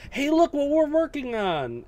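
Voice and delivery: lightly hammed-up hype voice; high slow mock announcement. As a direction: play the eager studio showing off. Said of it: "it's pretty much just".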